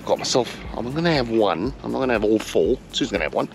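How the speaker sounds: ordinary talk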